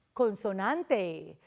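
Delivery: normal talking voice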